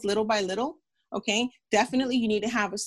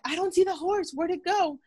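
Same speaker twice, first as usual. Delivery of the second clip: much higher, imitating a puzzled little kid; child voice; 9 semitones higher than usual. Doctor retells running to the bathroom as a child, high puzzled kid voice. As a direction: play yourself as a child hunting for the horse. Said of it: "me being a child".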